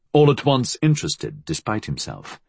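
ordinary delivery

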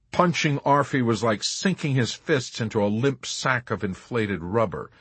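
ordinary delivery

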